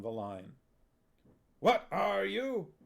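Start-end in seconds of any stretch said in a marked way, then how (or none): 1.6-2.7 slow, high barked drill question; Huel's drill call. Acted. Huel drilling the witches, slow high barked question. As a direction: bark it like a drill sergeant.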